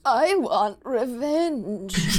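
put-on slow, creaky old man; old person voice. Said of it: "your old person voice".

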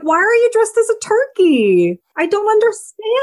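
high, baffled mock question; baffled disbelief. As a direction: play up the confusion at the costume. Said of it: "I was like".

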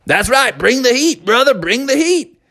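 put-on high, pumped-up kid voice; young bear daring the pitcher to throw; eager batter. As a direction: dare him to throw hard. Bubby dares the pitcher.

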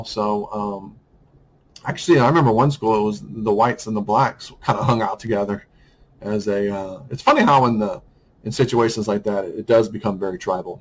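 usual speaking voice

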